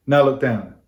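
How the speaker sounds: ordinary delivery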